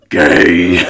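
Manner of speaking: loud, low Groot bellow. Retold or imitated. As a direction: drop low and boom it like Groot. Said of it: "in, like, the Groot voice".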